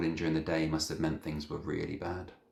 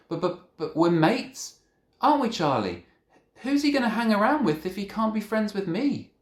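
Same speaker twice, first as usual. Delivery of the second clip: stunned protest; 7 dB louder than usual. A narrator's reading.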